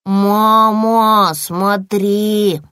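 drawn-out little bear cub voice; childish cub calling out. A narrator's reading. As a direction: call mother to look, stretching the words. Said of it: "ответил малыш".

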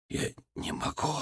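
weak, faint whisper. A narrator's reading. Frightened patient refusing food, whispered. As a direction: whisper it helplessly. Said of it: "прошептал он".

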